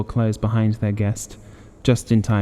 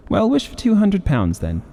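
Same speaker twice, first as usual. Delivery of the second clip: much higher, eager push; playful urging; 10 semitones higher than usual. A narrator's reading. Son urging his father to wish for money, playfully high. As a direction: cheerfully egg father on.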